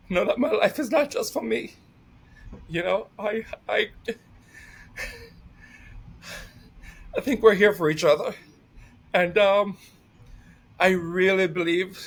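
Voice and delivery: choked up, crying while laughing through it; 울먹이며 웃음 섞인 목소리; tearful, voice breaking. Real, not acted. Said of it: "I didn't mean to cry".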